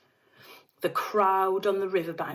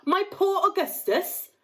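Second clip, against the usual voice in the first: high, fast wailing; mother wailing over her son's fate; distraught wail; 11 semitones higher, 4 dB louder than usual. A narrator's reading. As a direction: lament in horror. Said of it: "screamed Missus Gloop".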